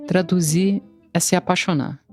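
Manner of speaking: ordinary talk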